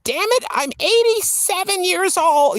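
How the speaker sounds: put-on old lady scolding Tim for not marrying her; shaky old lady. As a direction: play herself at eighty-seven nagging Tim. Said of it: "I imagine my voice just being shaky".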